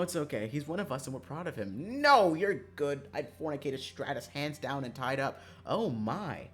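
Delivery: ordinary talk, nothing marked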